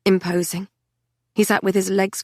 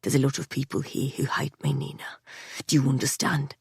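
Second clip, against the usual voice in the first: hushed urgent whisper; whispered warning in a crowd; low whisper; 5 semitones lower, 6 dB quieter than usual. A narrator's reading. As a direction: keep it low and urgent, for her ear only. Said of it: "he said low in my ear".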